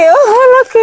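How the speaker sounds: high excited squeal; excited discovery. Real, not acted. Spotting a big one during the harvest, excited. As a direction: exclaim it way up high.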